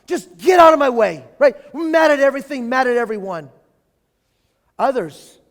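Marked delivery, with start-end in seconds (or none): 0.1-1.2 loud, high angry shout; snapping at the dog. Retold or imitated. Angry person shouting at the dog to move. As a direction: shove the dog off with a yell. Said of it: "Mad at the dog".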